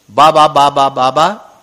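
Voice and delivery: put-on a little child babbling baba; lisping baby talk. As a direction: call out like a toddler. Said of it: "तुतलाते हुए बोलने लगे". (तुतलाती बच्चे जैसी आवाज़)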